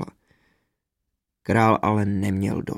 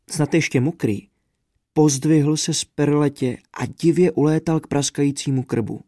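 plain reading voice

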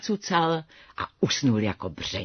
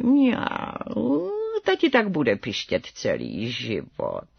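low, slow, hushed feline croon; meowing mother cat; 6 semitones lower, slower than usual. A narrator's reading. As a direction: purr the words and stretch them into a meow. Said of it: "A jeho černá maminka mňoukla".

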